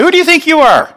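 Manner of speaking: high-pitched, indignant challenge; scolding a presumptuous child. Acted. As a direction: put them in their place, voice leaping up. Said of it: "especially a child, that was presumptuous".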